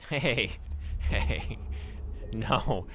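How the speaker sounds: loud laughing hey; laughing protest. Real, not acted. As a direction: laugh while pushing back.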